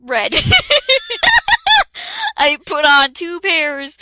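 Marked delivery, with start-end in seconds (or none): 0.4-2.3 loud, high laughter; can't stop laughing; shrieking laugh. Real, not acted. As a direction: squeal with laughter. Laughing at silly outfit.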